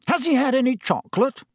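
high-pitched anxious question. A narrator's reading. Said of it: "he asked Madame Pomfrey anxiously".

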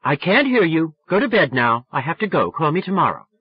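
firm dismissal. A narrator's reading. Sally getting off phone with drunk friend, exasperated. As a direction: cut him off firmly.

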